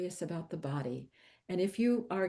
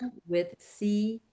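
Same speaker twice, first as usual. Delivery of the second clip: slow, letter by letter repeat; over enunciating; 5 semitones higher, 3 dB louder, much slower than usual. Shown as a demonstration. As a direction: carefully echo each letter. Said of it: "say it one more time slowly".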